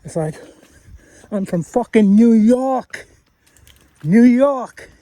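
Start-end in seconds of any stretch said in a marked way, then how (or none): 1.3-4.9 put-on loud, slow brash New York accent. Imitating a New Yorker for Jamie, loud mock New York accent. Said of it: "American or Italian accent? It's like".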